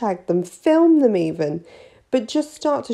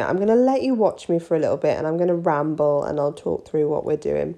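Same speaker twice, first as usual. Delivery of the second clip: ordinary talk, nothing marked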